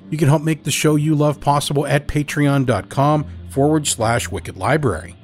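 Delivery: ordinary delivery, nothing marked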